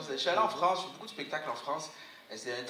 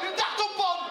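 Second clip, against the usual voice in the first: very high, loud reveal; triumphant chef shout; 5 dB louder, slower than usual. Acted.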